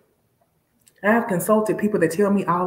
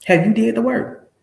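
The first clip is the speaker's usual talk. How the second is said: higher, louder challenge; 1 challenging repeat; 7 semitones higher, 5 dB louder than usual. Real, not acted. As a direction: push the question at them, demanding.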